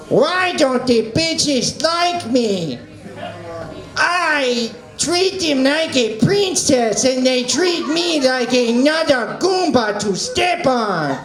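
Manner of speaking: slow, whiny cartoon villain with mock Italian accent; Waluigi impression. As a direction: complain theatrically, dragging out every word. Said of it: "impression of Waluigi complaining".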